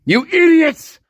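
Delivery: real exasperated yell; angry shout. Real, not acted. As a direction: scream it at them. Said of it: "I'm sorry for shouting".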